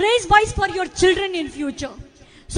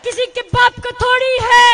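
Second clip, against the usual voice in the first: high, loud slogan shout; show of strength; 4 semitones higher, 7 dB louder, much faster than usual. Real, not acted. Speaker asking crowd to show strength, loud chant. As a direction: belt out the call to the crowd. Leads call-and-response slogan.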